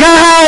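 high, loud mock cackle; hammed-up shriek of laughter; imitated laugh. Passenger reacts to pastor's joke, high imitated laughter. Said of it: "she was laughing".

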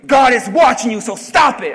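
loud, scolding preacher warning; a sudden loud mock reprimand; mock stern warning. Preacher mimicking the scolding message he won't give, mock stern. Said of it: "this isn't that type of message".

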